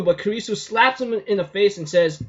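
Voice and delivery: ordinary talk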